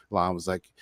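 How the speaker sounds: ordinary delivery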